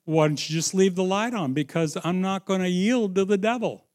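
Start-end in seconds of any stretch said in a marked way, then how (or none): none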